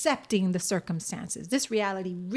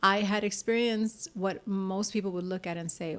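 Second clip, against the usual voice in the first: ordinary delivery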